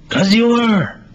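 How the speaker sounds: high, loud, eager voice. Acted. Playwright voices the eager friend reassuring Melvin, high and loud. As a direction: answer like it's obvious, bursting with certainty.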